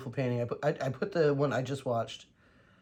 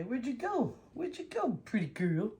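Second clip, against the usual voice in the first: high sing-song calling the dog; high pet voice calling the dog; pet talk; 9 semitones higher than usual. Real, not acted.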